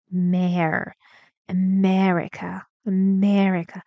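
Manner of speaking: "America" with MER loud and long; teacher drilling stress on "a-MER-ica"; stressed second syllable. Shown as a demonstration. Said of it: "The stressed syllable".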